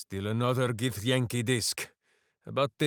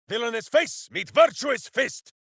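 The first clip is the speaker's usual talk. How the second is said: heroic battle cry; 8 semitones higher than usual. Acted. Ranger shouting as he punches a villain. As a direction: announce the punch with gusto. Combat bark.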